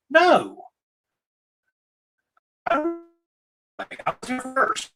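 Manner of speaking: a high, defiant no, then stubborn insistence; defiant mock stand. Streamer voicing the commenter who won't leave, defiant. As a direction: dig in like the local who refuses to move.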